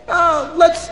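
high, loud burst; hammed-up eager shout; eager push. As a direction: jump in high and loud to close the deal. Officer pushing the deal eagerly.

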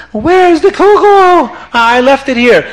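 high, dismayed shout of alarm; alarmed cry. Acted. Rebbetzin finds her kugel gone, alarmed high cry. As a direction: panic over the missing kugel.

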